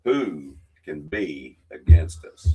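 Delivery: loud, measured Bible reading with weight on each clause; solemn church reading, building and falling; scripture reading. Acted. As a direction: proclaim the verses like a Sunday reading. Reads scripture aloud.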